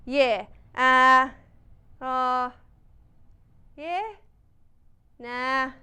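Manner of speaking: hesitant Aussie mumbles and grunts; Australian accent, fumbling a crisis reply; bumbling Australian president. Pretend. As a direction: stall like a lost Aussie leader. Plays Aussie president floundering.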